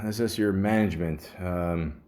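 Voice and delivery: normal talking voice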